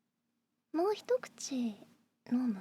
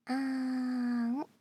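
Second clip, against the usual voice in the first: slow feeding coo; much slower than usual. Acted. Feeding the listener.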